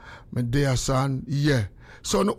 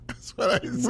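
says "That's what I say" again through a breathy laugh; laughing it off, half-whispered; 5 semitones higher, 5 dB quieter than usual. Real, not acted. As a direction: chuckle the words out, barely voiced.

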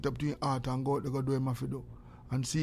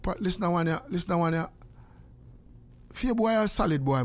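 ordinary talk

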